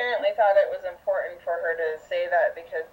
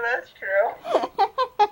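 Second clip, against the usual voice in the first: high, laughing that's true; that's true squeaked out with a laugh; laughing high agreement; 11 semitones higher, 3 dB louder than usual. Pretend. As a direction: giggle through a mock nod of agreement. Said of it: "you'll be like".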